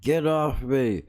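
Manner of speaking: lightly hammed-up damsel protest; mock sympathy-seeking. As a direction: imitate her playing the victim, slowly. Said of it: "work the sympathy card now".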